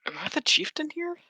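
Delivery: soft, high-pitched puzzled question; taken aback. Acted. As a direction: echo it quietly, rising in mild bewilderment. Character taken aback by stranger's question.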